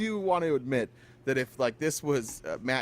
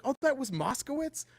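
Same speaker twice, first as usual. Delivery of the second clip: high, sing-song "oh, great" as if just realising; mock surprised reporters; 8 semitones higher than usual. Host mimicking reporters pretending to be surprised, high mock surprise. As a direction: ham up the reporters' fake surprise. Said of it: "you hear other reporters like".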